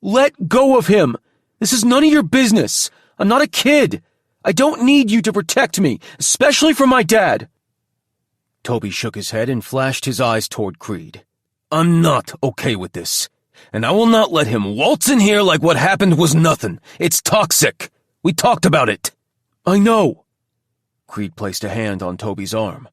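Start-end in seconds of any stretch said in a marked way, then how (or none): 0.0-7.5 angry outburst. A narrator's reading. Creed yelling at Toby. 11.7-20.2 heated argument. A narrator's reading. Toby and Creed argue.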